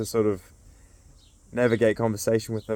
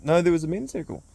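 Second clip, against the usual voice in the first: mock curious question; 8 semitones higher than usual. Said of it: "it's like".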